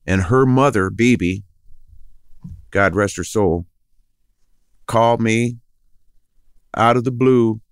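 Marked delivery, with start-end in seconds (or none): none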